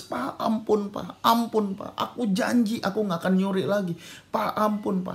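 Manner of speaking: high, pleading mock cry; imitated crying child. Beaten son begging his father for mercy, mock-tearful pleading. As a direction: beg Dad as the beaten boy, lightly acted. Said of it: "anak ini mulai nangis".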